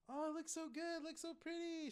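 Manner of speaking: high, soft imitation of admiring coo; mock gushing. Imitating friend admiring the room on video chat, high soft gushing.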